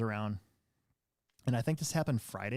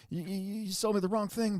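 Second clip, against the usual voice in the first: mocking customer; 8 semitones higher than usual. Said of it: "he's like".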